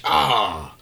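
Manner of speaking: ordinary talk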